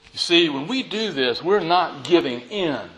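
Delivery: ordinary delivery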